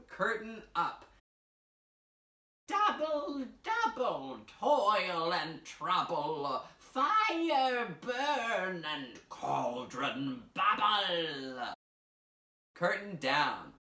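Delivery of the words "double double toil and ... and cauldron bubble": witch voice. Pretend. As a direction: stir the cauldron as a hunched witch. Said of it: "what might be our witchy voice".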